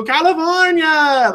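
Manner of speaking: very high, drawn-out Olive Oyl call; Shelley Duvall. Retold or imitated. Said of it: "like that".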